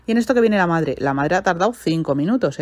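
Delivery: normal talking voice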